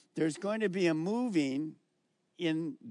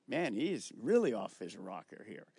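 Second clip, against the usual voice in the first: lightly voiced doubting companion, lower and quieter; skeptical onlooker; 7 semitones lower, 4 dB quieter than usual. Saul's companions doubt his sanity in a lightly voiced aside. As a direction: mutter it like a doubting bystander. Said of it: "they're thinking".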